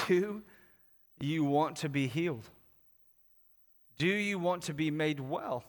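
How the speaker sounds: soft and low, with long pauses; quiet, searching question. Acted. Jesus to the man at the pool, quiet question.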